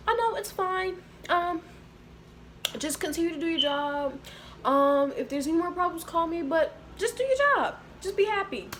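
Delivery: high-pitched, hesitant mock reassurance; smooth it over in a high imitated voice; high mimicry of a boss brushing off a complaint; imitated placating supervisor. Retold supervisor placating her on phone.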